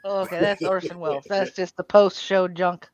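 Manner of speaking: ordinary delivery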